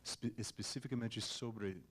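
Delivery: Portuguese with an English accent; American speaker in careful learner Portuguese. Real, not acted. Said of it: "Desculpa o meu português".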